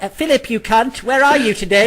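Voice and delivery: high, lightly voiced posh Queen; mock Queen. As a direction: play the Queen cursing casually, a bit high. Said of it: "the Queen does not wake up like".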